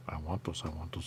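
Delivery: "I want those." whispered, unvoiced and soft. Shown as a demonstration. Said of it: "repeated as whisper".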